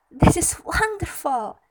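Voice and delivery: high, loud wonder; delighted exclamation. A narrator's reading. Cinderella delighted by her new gown.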